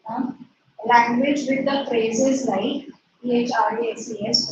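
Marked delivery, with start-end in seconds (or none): 3.2-4.5 spells P H R A S E S letter by letter; name each letter of P H R A S E S for the class; spelling out. Shown as a demonstration. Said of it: "put it in inverted comma".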